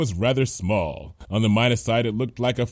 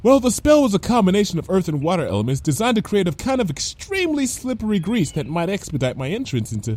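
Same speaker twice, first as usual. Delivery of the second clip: fast, high-pitched chatty explanation; quick, unworried character voice; Lyra, blithe; 9 semitones higher, faster than usual. Acted. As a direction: rattle off the explanation brightly.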